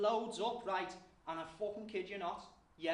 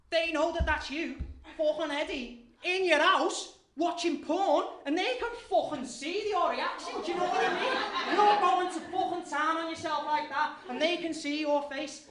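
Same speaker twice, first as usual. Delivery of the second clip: louder and higher, ranting with laughs breaking in; raging rant; 7 semitones higher, 7 dB louder than usual. Real, not acted. Comic raging at the crowd about phones watching them. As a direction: work yourself into a furious, laughing rant. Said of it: "I'm going raging there".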